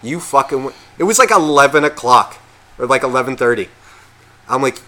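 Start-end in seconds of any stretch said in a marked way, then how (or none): none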